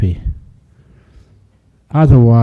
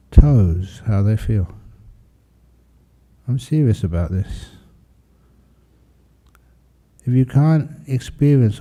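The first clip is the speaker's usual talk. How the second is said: guided meditation; 3 dB quieter than usual. Acted. Monk settles his class into relaxing the body, guided meditation. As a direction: ease them into relaxation, unhurried and gradually softer.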